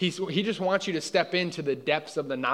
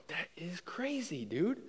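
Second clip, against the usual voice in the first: slow, quiet, stunned; hushed awe; 7 semitones higher, 8 dB quieter, slower than usual. Retold or imitated. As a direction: let it out in slow, hushed disbelief. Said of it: "And I was like".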